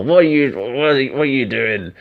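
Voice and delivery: high, loud mock outrage, stumbling over repeats; hammed-up grab-him-by-the-collar demand; confronting. Wrestler pinning the referee to the wall, mock-furious.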